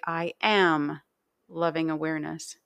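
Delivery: "am" held out, the rest relaxed; emphasize "am", then ease into the rest; stress on AM. Shown as a demonstration. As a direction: lean on "am" and pause after it. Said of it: "you're emphasizing each word each time".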